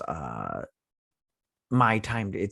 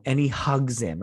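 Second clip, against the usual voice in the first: ordinary talk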